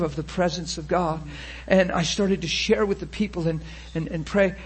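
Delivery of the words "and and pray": ordinary delivery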